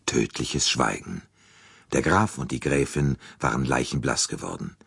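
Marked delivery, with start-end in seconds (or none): none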